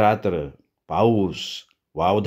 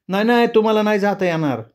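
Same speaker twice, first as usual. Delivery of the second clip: much higher and fast; eerie woman forbidding; 8 semitones higher, faster than usual. Acted. The ghostly woman refuses to let him leave, high and quick. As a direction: stop him, insistent.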